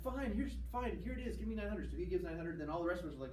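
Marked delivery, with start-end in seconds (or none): 0.0-1.9 higher, mock exasperated surrender; reluctant giving in. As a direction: give in with a put-on huff, pitched up. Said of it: "she's like".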